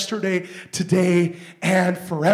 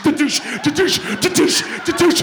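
laughing mock bumps, higher; rhythmic laughing da-douche; thud sound imitation; 8 semitones higher, 7 dB louder than usual. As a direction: knock it out like a head on a dash. Imitating head hitting dashboard.

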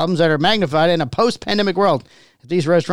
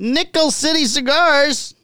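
slow, higher announcer call; 7 semitones higher, slower than usual. Acted. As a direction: announce the shop name slowly, with flair.

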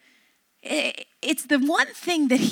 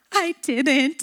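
imitating a child close to tears; mock quivering-lip protest; little kid about to cry, acted lightly; tearful denial. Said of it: "lip quivering and tears welling up".

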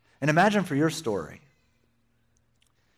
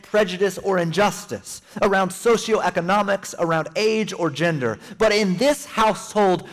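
raised, ringing sermon delivery; preaching; 6 semitones higher than usual. Acted. Pastor rallying the church, full-force preaching. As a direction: preach it out to the congregation.

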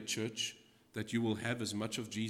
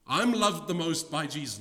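higher, louder boastful claim; squabbling disciple; 6 semitones higher, 8 dB louder than usual. Acted. Preacher voicing a disciple boasting to the others, mock squabble. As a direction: brag like a child who wants first place.

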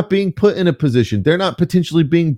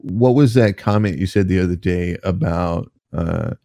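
reporter voice; 7 semitones lower than usual. Pretend. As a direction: play a low-voiced reporter asking a question. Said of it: "something that a reporter would ask".

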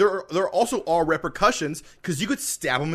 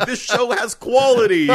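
louder laughing line; 8 semitones higher, 9 dB louder than usual. Real, not acted. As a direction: laugh through the words.

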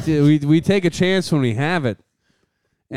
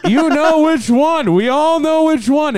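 higher and louder, drawn-out knowing tone; knowing emphasis; 10 semitones higher, 4 dB louder than usual. Acted. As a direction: lean into it like everyone knows. Mocking the train singer.

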